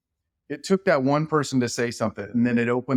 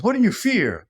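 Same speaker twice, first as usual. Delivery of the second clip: a coach barking the question, pitched well up and loud; lightly hammed-up coach asking high and loud; movie coach; 10 semitones higher, 4 dB louder than usual. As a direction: play the coach putting the team on the spot. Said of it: "And he goes".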